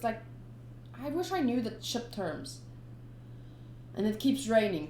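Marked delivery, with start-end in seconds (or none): none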